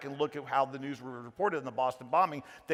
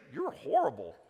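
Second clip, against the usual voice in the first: imagined victim's thought; 3 semitones lower, 3 dB quieter than usual. Retold or imitated. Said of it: "You're thinking".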